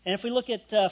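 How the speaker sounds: ordinary talk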